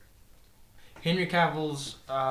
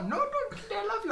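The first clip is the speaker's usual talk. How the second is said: high sweet voice; 6 dB quieter than usual. Pretend. Acting out split personality.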